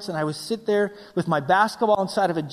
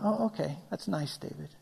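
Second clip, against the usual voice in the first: soft, humouring reply; unimpressed God; 2 semitones lower, 10 dB quieter than usual. Acted. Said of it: "God must have been sitting there going".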